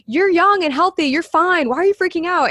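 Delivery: incredulous friends. Retold or imitated. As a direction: scoff at the careful friend. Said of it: "they're like".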